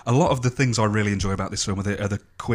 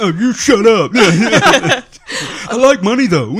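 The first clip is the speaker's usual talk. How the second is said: thick-tongued dopey voice, laughing; slow, thick tongue, half laughing; fat-tongued voice; 11 semitones higher, 9 dB louder, much slower than usual. Retold or imitated. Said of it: "speaks like he's got a fat tongue".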